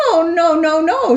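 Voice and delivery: high, loud little wolves crying no. Acted. Little wolves refusing the pig, high and loud. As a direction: squeal the no like frightened wolves.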